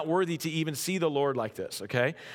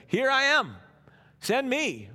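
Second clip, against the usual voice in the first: high, loud and eager; Isaiah eagerly volunteering, high and loud; 10 semitones higher, 3 dB louder, slower than usual. Retold or imitated. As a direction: jump at the call like a keen volunteer. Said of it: "He's like".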